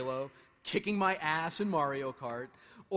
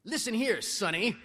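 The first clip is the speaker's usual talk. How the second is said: lightly voiced grumpy old man, louder; a hint of a cranky old man scolding; 5 semitones higher, 6 dB louder than usual. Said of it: "in my very best grumpy old man voice".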